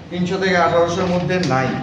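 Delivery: ordinary delivery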